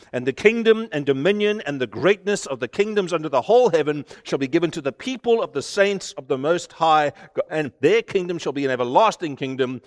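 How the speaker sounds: rising preacher's proclamation; preaching climax. Acted. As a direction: proclaim it to the congregation.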